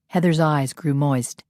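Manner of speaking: ordinary delivery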